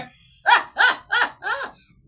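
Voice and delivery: very loud, high-pitched cackle; laughing. Real, not acted. Vlogger cracking up at her own joke, loud high laughter. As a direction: burst out in high, helpless laughter.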